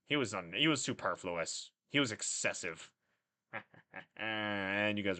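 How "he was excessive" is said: normal talking voice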